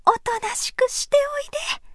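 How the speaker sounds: put-on whispered hushing to children; soothing a child. A narrator's reading. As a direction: whisper them to be still. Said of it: "なだめました".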